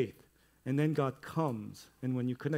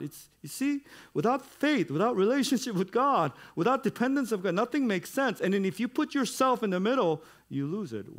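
much higher and louder, impassioned preaching; preaching at full force; 9 semitones higher, 6 dB louder than usual. Acted. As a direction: drive the point home to the congregation. Preaching climax.

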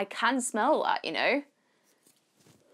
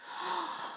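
quick soft gasp of recognition; surprised gasp; 8 semitones higher, 10 dB quieter than usual. Real, not acted. Spotting a favourite brand in the box, gasp. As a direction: gasp as you spot the brand.

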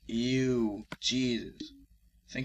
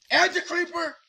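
panicked shriek; 8 dB louder than usual. Real, not acted. Creeper appears, panic.

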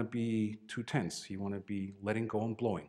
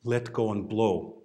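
slow catchphrase; 5 dB louder, slower than usual. Shown as a demonstration. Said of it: "every time I said during teaching".

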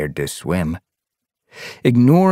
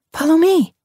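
put-on high, loud young she-cat; telling her; 4 dB louder than usual. A narrator's reading. Sun Paw leads her friend, high and firm. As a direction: lead the way firmly. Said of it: "Sun Paw told her".

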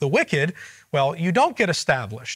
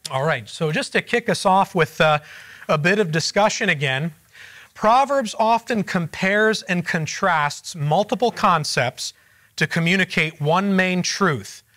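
normal talking voice

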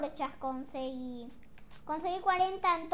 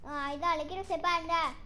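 normal talking voice

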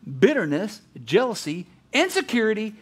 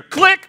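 mock click sound; 8 semitones higher, 7 dB louder than usual. Imitating phone hang-up.

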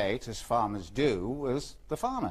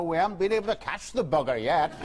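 laughing; 8 semitones higher, faster than usual. Acted. Punchline of his own joke.

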